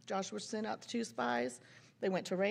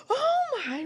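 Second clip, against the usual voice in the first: high, loud mock gasp of dismay; horrified realization; 9 dB louder than usual. Mom realizing her daughter's costume mistake, horrified outburst. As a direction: act out sudden mortification.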